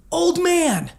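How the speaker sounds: high, drawn-out heckle across the stands; taunting call. Retold or imitated. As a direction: call it out like a gloating fan. Re-enacting his taunt at a game.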